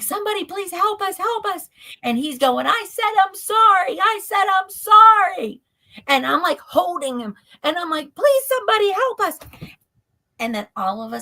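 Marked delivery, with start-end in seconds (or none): 0.0-1.7 high, fast retold cry for help; tearful plea for help. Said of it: "I'm, like, crying". 2.7-5.6 loud, high, desperate apology; retold screamed apology. 8.1-9.4 high-pitched, desperate retold plea; tearful plea for help. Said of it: "I'm, like, crying".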